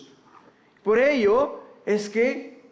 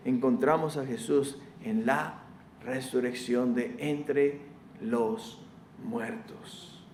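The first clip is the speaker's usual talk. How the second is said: softly, lower, a preacher's hushed conviction; hushed solemn; 7 semitones lower, 7 dB quieter than usual. Real, not acted. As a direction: lower and softer, slow reverent preaching. Hushed solemn climax of sermon.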